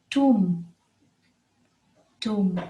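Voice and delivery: tomb ending on M; silent B. Shown as a demonstration. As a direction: say "tomb" with no B sound. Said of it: "Another word with the silent B".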